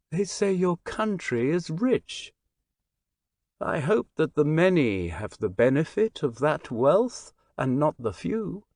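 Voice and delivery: a lightly voiced stranger, sweet and earnest with a hint of foreign accent; the gentle stranger sharing his hopes, a touch foreign. Stranger telling the Englishman his hopes, lightly voiced and earnest.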